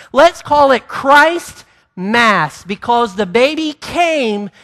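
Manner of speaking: loud, high fervent preaching. Acted. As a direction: preach it with full force. Preaching at full force.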